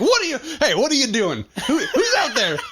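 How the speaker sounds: high, laughing alarmed calls; hammed-up startled neighbour; calling out at noises outside, half laughing; mock alarm. Imitating own jumpy reaction.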